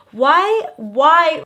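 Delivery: sudden high, loud "why"; incredulous outburst. Real, not acted. Vlogger reacting to a blind date story, incredulous outburst. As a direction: blurt it out in disbelief.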